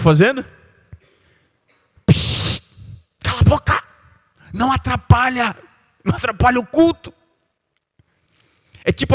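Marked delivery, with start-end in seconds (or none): 2.1-2.6 a sharp voiceless shush; hushing. Retold or imitated. The crowd shushing the blind beggar, voiceless. 3.2-7.1 higher, scolding church-goer voice; irritated hushing. Retold or imitated. Crowd silencing the blind man.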